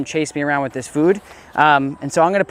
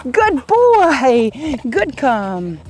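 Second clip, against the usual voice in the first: high, bright, loud dog praise; squeaky praising a dog; 10 semitones higher, 4 dB louder, much slower than usual. Real, not acted. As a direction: praise the puppy in a squeaky happy voice. Trainer calling and praising dog.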